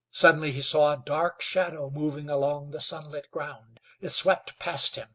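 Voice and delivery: ordinary delivery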